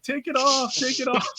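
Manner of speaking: put-on high squealing girls voice; screaming girls. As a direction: shriek it like grossed-out girls. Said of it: "all the girls are screaming. They're like".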